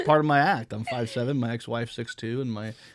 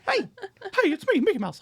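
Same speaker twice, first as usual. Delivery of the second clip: much higher, giggly cartoon mouse voice; Mickey Mouse voice; 6 dB louder than usual. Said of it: "do the Mickey Mouse voice".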